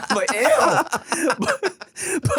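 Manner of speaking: giggling fit; laughing. Real, not acted. Host cracking up at his own joke, laughing hard.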